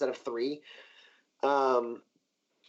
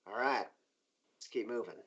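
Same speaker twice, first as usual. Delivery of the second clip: low, slow, understated leader; lightly voiced boss, soft and lowered; Rosa's quiet order; 5 semitones lower, 9 dB quieter than usual. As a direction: give a hushed, unhurried command. Game master voices Rosa giving orders.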